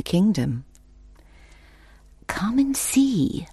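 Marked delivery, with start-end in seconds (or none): 2.3-3.5 slow, high creepy whisper; whispering old woman. A narrator's reading. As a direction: lure her in with a whisper. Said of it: "replied the old woman".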